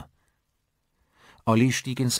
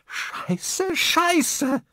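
very high, panicked swearing, getting louder; horrified; 5 dB louder than usual. A narrator's reading. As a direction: curse in horror, building to a cry. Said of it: "In ihrem Ton war echtes Entsetzen". (entsetzt, schrill steigernd geflucht)

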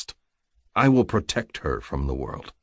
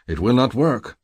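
louder, higher burst of frustration; understated fury, raised voice; suddenly furious; 7 semitones higher, 5 dB louder than usual. A narrator's reading. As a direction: declare it with sudden heat. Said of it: "suddenly furious".

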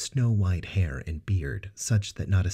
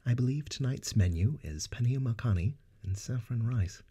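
high, gentle, courteous host voice; gentle Narsil Banks; 7 semitones higher than usual. Acted. Narsil Banks gently tells the guests dinner awaits, high and kind. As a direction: kindly announce dinner to guests. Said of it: "Narsil Banks said gently".

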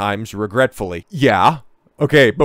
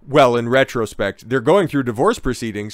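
ordinary talk

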